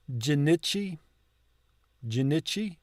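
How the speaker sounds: Jinichi with short ih, as jih-NIH-chee; short I sound. Shown as a demonstration. Learner asking about both I's, Jinichi with short ih. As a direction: softly try out Jinichi as a question. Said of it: "Are both I's pronounced like I?".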